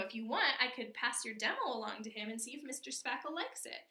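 ordinary talk

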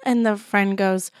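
normal talking voice

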